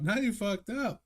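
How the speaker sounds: squeaky high crab quote. Retold or imitated. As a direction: pitch way up like a tiny crab. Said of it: "Every time a crab pops up".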